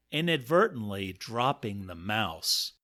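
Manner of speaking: ordinary delivery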